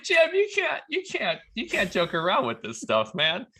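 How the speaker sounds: high, loud laughing scold. Retold or imitated. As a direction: laugh through the words in disbelief. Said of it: "Wayne actually laughs at him".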